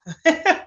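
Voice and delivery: quick real giggle; a little rising laugh at herself; amused laugh; short laugh. Real, not acted. Laughs at her tiredness.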